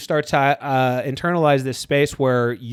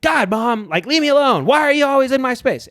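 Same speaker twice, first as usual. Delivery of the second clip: exasperated whine pitched much higher; annoyed old me; 9 semitones higher than usual. Retold or imitated. Grown child snapping at mom to be left alone, high exasperated whine. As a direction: whine it like a fed-up teenager pushed too far. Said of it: "the old me would be like".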